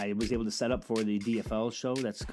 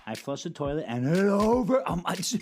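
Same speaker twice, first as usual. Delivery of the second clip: higher, rising into a loud squeal at the end; daughter's voice; 8 semitones higher, 6 dB louder than usual. Retold or imitated. Daughter explaining the toilet to dad, building loud. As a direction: kid rambling, then jumping up loud.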